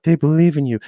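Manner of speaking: hammed-up encouraging inner voice; mock cheesy voice. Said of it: "be like".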